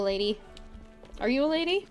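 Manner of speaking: ordinary talk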